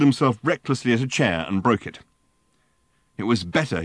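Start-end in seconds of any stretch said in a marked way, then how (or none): none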